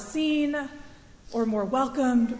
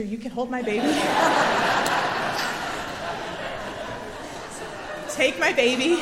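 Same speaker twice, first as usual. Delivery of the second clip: laughing relief. Real, not acted. Exhausted mother handing baby to stranger, laughing. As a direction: giggle it out, relieved.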